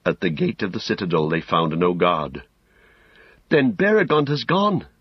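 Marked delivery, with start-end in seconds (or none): 3.5-4.9 high voice with a touch of hope; Pippin, more hopeful. A narrator's reading. Pippin finds no guard at the gate, more hopeful. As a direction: lift the voice, a little hopefully. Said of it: "said Pippin more hopefully".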